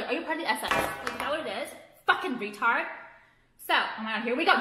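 ordinary talk, nothing marked

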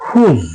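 হুজ with the oo of blue and a held z; silent w. Shown as a demonstration. Teacher repeats whose for viewers, rhyming oo sound. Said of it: "সেই একই রকম".

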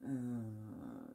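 normal talking voice

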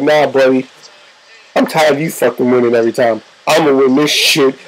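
low, defiant wrestler's retort; deep-pitched trash talk, acted; Triple H. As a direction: drop deep and refuse to back down. Acting Triple H's defiant retort.

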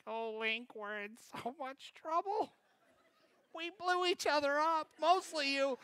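high, thin panicky voice rising into a laugh; half-laughing childish panic; panicked kid. Retold or imitated. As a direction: fret to your brother that you're in trouble. Panicking to brother after blast.

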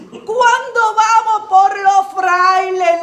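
normal talking voice

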